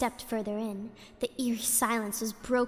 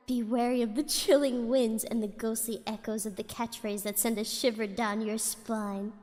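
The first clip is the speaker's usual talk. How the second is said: ordinary delivery, nothing marked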